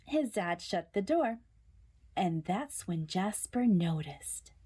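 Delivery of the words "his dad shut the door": plain reading voice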